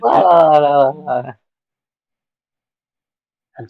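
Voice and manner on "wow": loud laughing wow. Real, not acted. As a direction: crack up on the word.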